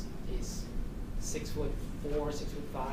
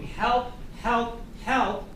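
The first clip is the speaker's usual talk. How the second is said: higher, louder calls of help; mock cry for help; 8 semitones higher, 7 dB louder, much slower than usual. Defense lawyer mocking a call for help, raised and loud. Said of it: "instead of screaming".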